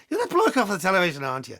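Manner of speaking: put-on a quick repeat in a light Scottish accent; lightly imitating the Scottish local. Retelling the handler's words in a light Scottish accent. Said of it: "Excuse the Scottish accent".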